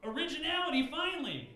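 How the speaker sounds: high, loud delighted shout; excited. Pretend. Comic cheering an original Christmas song. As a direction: cheer like a thrilled fan. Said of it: "I'd be like".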